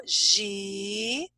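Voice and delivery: slow, drawn-out syllables with clear pauses; teacher separating a word into its sounds. Shown as a demonstration. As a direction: stretch each syllable and hold the hissed S. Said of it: "Eu tenho três sílabas".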